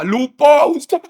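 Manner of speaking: high, rising flustered cry; imitated sheepish high-pitched exclamation; flustered realisation. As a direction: blurt out a sudden realisation. Acts man's flustered realisation.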